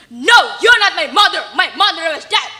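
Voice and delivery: loud, harsh denial shout; loudly. Acted. Rich son denying his own mother, loud and harsh. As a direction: shout her down coldly. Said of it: "Malin answered loudly".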